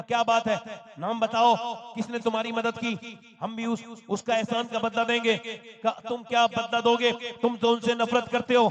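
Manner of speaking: loud, rising sermon delivery; impassioned preaching. Acted. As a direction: drive the quoted exchange up to a ringing climax. Preaches a dialogue, building to climax.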